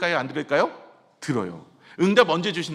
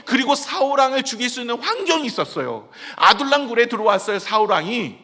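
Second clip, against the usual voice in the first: louder, higher preaching that builds and holds; fervent preaching; 7 semitones higher, 8 dB louder than usual. Acted. Pastor urging the congregation to wait on God, fervent preaching. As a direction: preach it out with full force to the pews.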